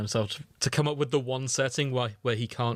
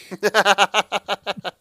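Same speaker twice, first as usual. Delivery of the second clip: loud, high burst of laughter; 8 semitones higher, 10 dB louder than usual. Real, not acted. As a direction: crack up at the tag. Laughs at tag.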